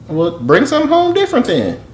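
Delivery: high-pitched exasperated suggestion. Real, not acted. Husband on the phone about what to bring home, exasperated. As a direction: push the voice way up, a little fed up.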